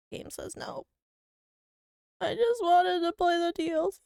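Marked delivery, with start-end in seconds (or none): 2.2-4.0 high, whiny mock sob voice; whimpering bit, played up; fake crying. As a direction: put on a weepy whine. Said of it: "I shouldn't talk like that because Koshi's gonna come in thinking I'm actually crying".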